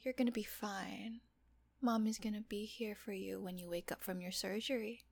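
ordinary talk, nothing marked

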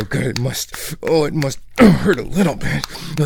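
normal talking voice

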